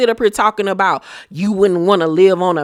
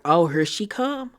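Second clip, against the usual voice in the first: baiting; 4 dB quieter than usual. Retold or imitated. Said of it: "really baiting her".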